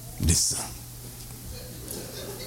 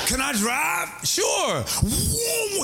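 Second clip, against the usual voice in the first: high-pitched mock shouts of the exchange; hammed-up cry as the car speeds off; mock high exclaims; much slower than usual. Acting out lending the car.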